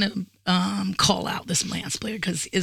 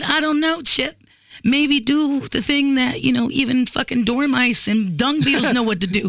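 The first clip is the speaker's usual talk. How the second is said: higher, slow sarcastic retort; retold rising anger; 4 semitones higher than usual. Woman retorting to doubting friend, annoyed sarcasm. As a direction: spell it out with mounting irritation. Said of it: "I'm getting mad now".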